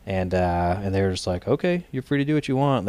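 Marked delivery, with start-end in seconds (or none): 1.5-2.9 higher, mocking arrogant dismissal; arrogant brush-off. Staffers brushing off an angry visitor, mock arrogant. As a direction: imitate the staffers' smug shrug-off. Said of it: "They had kind of an arrogant attitude about it".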